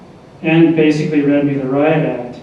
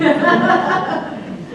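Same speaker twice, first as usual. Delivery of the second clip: a light falling laugh at himself; real fond laugh. Real, not acted. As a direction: laugh it off fondly. Laughing at his stupid nonsense.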